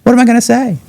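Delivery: very high, squeaky and put-upon; hammed-up high mother, fretting; mock mom voice. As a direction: pitch way up like his mother complaining.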